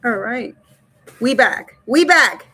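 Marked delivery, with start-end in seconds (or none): 1.2-2.5 loud, happy chant of a comeback; a pumped shout of we back; hyped cheer. Real, not acted. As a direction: call it out to the chat like a victory. Streamer cheering return to stream.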